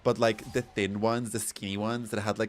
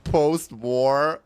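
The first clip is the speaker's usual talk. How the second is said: loud, rising incredulous post-war; mock disbelief; 5 semitones higher, 8 dB louder than usual. Vlogger retelling reply to friend, loud mock disbelief. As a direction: throw it back in disbelief. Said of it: "But I was like".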